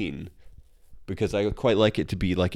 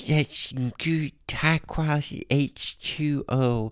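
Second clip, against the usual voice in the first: slow, higher mock Waterboy voice; higher, softened goofy Waterboy impersonation; Waterboy accent; 5 semitones higher, slower than usual. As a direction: imitate Sandler's Waterboy accent, drawn out. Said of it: "he did a accent in The Waterboy".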